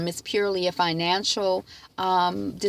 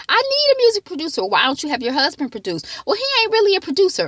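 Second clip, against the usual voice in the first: a high, loud, fast back-and-forth; quick imitated quarrel; mock argument; 11 semitones higher, 7 dB louder, faster than usual. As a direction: play out the squabble, hammed up. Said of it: "Lady May was like".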